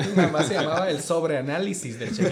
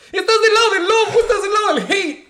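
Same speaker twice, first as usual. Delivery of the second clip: high, loud laughing challenge; excited shout; 11 dB louder than usual. Acted. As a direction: call it out like a game show. Love or hate challenge.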